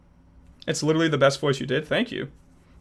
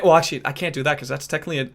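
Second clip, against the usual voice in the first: ordinary talk, nothing marked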